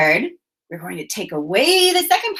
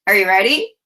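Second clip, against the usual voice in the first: ordinary delivery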